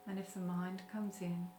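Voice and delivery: guided meditation. Acted. Teacher leading listeners through a short breathing meditation, calm guided meditation. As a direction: gently guide them into stillness, unhurried.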